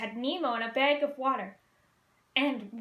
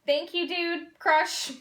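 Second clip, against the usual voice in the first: mild shout; 5 semitones higher, 5 dB louder, much slower than usual. Acted. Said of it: "Marlin shouted".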